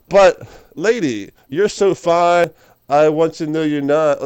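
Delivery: slow, loud, hammed-up come-on; guy calling after a woman leaving, mock pleading; mock cheesy pickup line. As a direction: overdo a desperate pickup line.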